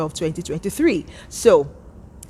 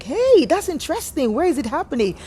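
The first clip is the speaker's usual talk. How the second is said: interested; 9 semitones higher than usual. Retold or imitated. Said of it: "I'm like".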